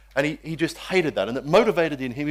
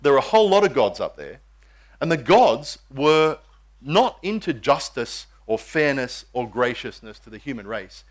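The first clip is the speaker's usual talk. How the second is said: usual speaking voice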